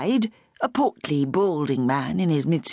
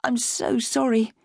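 slow, nervous apology; sorry caught in the throat; gulping apology; 7 semitones higher, slower than usual. A narrator's reading. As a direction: swallow hard before saying it. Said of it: "she gulped".

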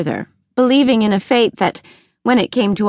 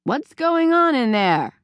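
mother calling from the next room, a little higher and slower; 7 semitones higher, 3 dB quieter than usual. A narrator's reading. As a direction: call through the wall, puzzled. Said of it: "Mutter called out".

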